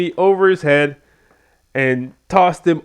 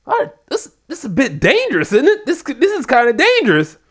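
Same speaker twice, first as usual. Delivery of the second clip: much higher, wobbly nervous voice; nervous whine; 11 semitones higher than usual. Retold or imitated. Nervous wrestler eyeing the ramp, high nervous whine. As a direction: play a scared man talking himself into it. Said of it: "some kind of nervousness about actually doing the stunt".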